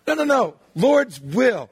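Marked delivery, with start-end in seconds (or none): none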